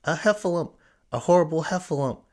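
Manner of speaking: high, loud, terrified shout; frightened cry. A narrator's reading. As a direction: yell it in a panic while running. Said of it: "still crying out".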